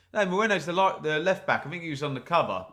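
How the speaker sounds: usual speaking voice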